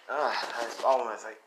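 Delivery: ordinary talk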